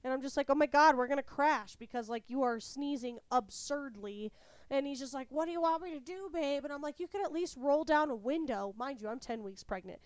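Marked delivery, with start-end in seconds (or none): 0.5-1.8 loud, higher panicky outburst; retold alarm. Wife to sneezing driver husband, retold panic. 5.3-6.6 high, louder helpless protest; exasperated husband. Retold or imitated. Husband to nagging wife in the car, exasperated. 7.0-8.7 higher, louder nagging demand; retold scolding. Pregnant wife to sneezing husband, annoyed scolding.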